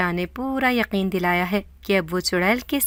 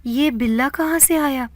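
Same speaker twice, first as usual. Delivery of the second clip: shaky, higher frightened question; trembling voice; 5 semitones higher than usual. A narrator's reading. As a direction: ask it with a quavering voice. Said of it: "لرزتی ہوئی آواز میں کہا".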